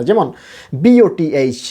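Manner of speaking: normal talking voice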